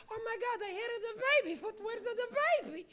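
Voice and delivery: put-on high old Italian woman voice; mock-worried little old lady. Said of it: "Italian lady was sitting in the corner".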